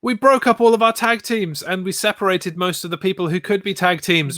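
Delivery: hammed-up panicking bosses; mock panic. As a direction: play the flustered writers in a mock fret. Mocking panicked WWE writers.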